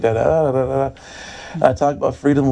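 ordinary talk, nothing marked

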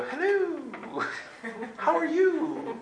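very high, drawn-out hello; cooing greeting. Retold or imitated. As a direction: coo a hammed-up greeting to a friendly angel. Imagined greeting to warm glow.

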